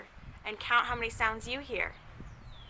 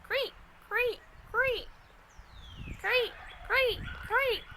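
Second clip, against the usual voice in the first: much higher squeaky chirps; high-pitched cricket greets; 13 semitones higher, slower than usual. A narrator's reading. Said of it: "The crickets go".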